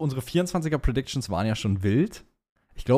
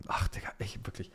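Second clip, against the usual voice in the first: exasperated whisper; whispered frustration at yourself; 3 semitones lower, 12 dB quieter than usual. Real, not acted. Frustrated at own fumbling.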